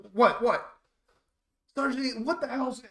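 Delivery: loud, high angry scolding; chewing him out. Retold or imitated. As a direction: tear into him. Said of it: "he's chewing him out".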